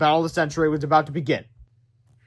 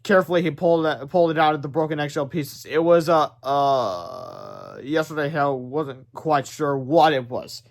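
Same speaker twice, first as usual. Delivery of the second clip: ordinary delivery, nothing marked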